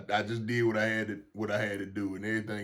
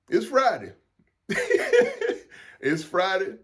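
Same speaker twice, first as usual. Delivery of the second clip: laughing as he says it's Friday; chuckling through the words, louder; 9 semitones higher, 4 dB louder than usual. Real, not acted.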